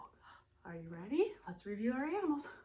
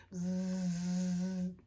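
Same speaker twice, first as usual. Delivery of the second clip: low steady buzz; buzzing bee; 4 semitones lower than usual. Shown as a demonstration. Teacher buzzing like a bee for kids.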